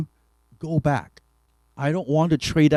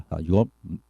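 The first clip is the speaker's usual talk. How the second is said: normal talking voice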